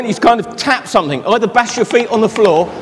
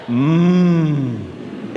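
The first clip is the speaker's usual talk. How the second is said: low, soft mmm of delicious food; showing the children the food sound, a low happy hum; yummy hum; 6 semitones lower, 4 dB quieter than usual. Shown as a demonstration. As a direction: hum as if smelling dinner. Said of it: "I want you all to go".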